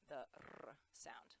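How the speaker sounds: the R sound with a rolled trill. Shown as a demonstration. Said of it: "the rolled R".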